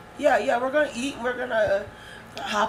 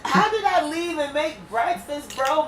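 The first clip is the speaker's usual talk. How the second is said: higher, exasperated protest; indignant; 6 semitones higher than usual. Real, not acted. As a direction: argue back, outraged.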